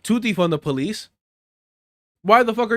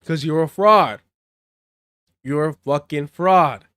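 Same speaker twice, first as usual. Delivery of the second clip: louder, slow and cutting; angry accusation; 5 dB louder, slower than usual. Real, not acted. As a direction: spit out the word fraud. Calling politician a fraud.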